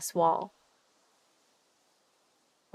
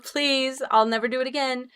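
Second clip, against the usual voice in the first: ordinary talk, nothing marked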